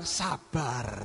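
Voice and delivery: a soft, low calm; soothing God. Retold or imitated. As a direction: quietly tell them to be patient. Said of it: "Tuhan bilang". (Tuhan berkata sabar dengan lembut dan pelan)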